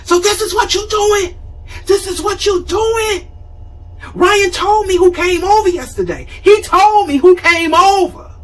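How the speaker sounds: high, loud, accusing mock yell at a grown daughter; mother yelling. Mother bursts in, yelling at her daughter about a visitor, high accusing yell. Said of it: "she just walks in yelling".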